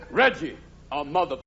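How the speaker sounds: ordinary talk, nothing marked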